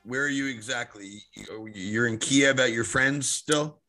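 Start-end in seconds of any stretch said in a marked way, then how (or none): none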